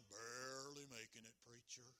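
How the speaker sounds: low, soft, mopey reply; gloomy churchgoer. Retold or imitated. Churchgoer moping back at the preacher, low and glum. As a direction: sound like you're barely holding on.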